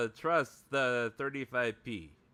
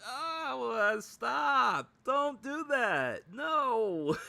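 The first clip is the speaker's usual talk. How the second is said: high, drawn-out plea; wailing comic protest; mock pleading; 11 semitones higher, much slower than usual. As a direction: beg in exaggerated dismay, pitch up. Acting out exasperated viewer pleading.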